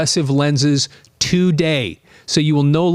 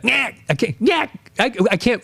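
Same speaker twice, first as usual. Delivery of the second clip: mock Ace cackle; 5 semitones higher than usual. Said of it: "I feel stupid trying to make the noise".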